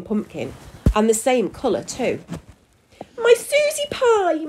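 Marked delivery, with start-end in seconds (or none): 3.2-4.5 very high, drawn-out wail; distressed cry. A narrator's reading. Father sees his daughter transform, crying out. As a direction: cry out slowly, pitched way up. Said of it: "Prune cried".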